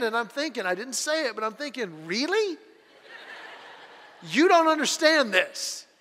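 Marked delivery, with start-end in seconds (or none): 2.1-5.9 high, incredulous question; disbelieving inner retort, pitched up. Retold or imitated. Thinking back at his sister, incredulous.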